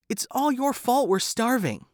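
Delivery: high, upset wife pleading; lightly voiced distressed woman. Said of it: "said a distressed woman".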